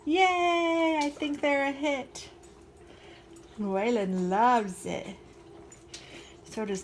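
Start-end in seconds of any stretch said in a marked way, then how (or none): 0.1-2.3 higher, louder happy cheer; excited cheer. Real, not acted. Baker cheering that her dogs love the treats, excited. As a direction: celebrate the win out loud.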